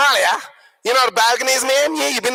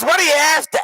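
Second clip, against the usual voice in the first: higher-pitched broad Aussie waiter; rough Australian bogan accent; 6 semitones higher, 3 dB louder than usual. Acted. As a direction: ask it in a broad Australian twang. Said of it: "very broad Australian accent".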